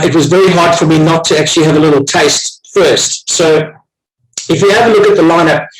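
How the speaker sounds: ordinary talk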